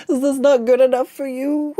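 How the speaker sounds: high, put-on wounded pout; playfully fake-hurt question; mock offended. Mock-offended about her horse design.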